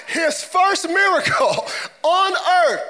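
high, slow, emphatic shout; incredulous exclamation. Real, not acted. Preacher marvelling to church at first miracle, incredulous. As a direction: hammer it out in amused disbelief.

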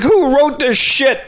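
high, slowed-down mock whine of protest; whiny protest. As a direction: whine back defensively about the script. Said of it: "I'm like".

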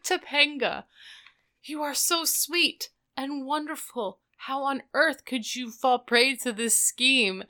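high-pitched mock adoration turning to dismay; gush, then plead, pitched high; hammed-up lovestruck appeal; acted dismayed plea. Retold dismayed plea to Topanga.

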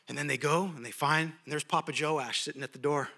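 usual speaking voice

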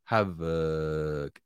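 "Havoc" with a faint schwa between v and c. Shown as a demonstration. Host replaying havoc to show the faint schwa. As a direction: let a tiny vowel slip into "Havoc". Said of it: "Ese sonido, es la schwa".

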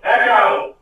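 high, loud echoed call; yelling out. Pretend. Echoing the co-host's call, yelled out. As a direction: shout it back like an echo. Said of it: "yell out Echo as it echoes".

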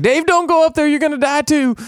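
high, urgent warning cry; panicked boy. Retold or imitated. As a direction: yell the warning in a high, frantic voice. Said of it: "he's like".

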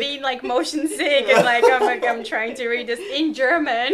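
slow, loud, laughing. Real, not acted. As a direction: laugh your way through it. Laughs recalling motion sickness.

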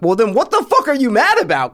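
talking back to the daughter on screen, exasperated; exasperated outburst. Retold or imitated. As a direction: snap back at her in disbelief. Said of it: "And I'm like".

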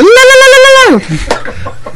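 high trilling la la la. Pretend. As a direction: sing out high la-la calls.

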